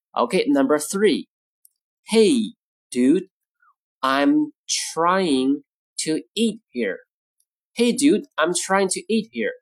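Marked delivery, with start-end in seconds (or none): 2.1-7.1 broken into short phrases with pauses; slow model reading. Shown as a demonstration. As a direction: say it word by word for learners. Model sentence, slow take first.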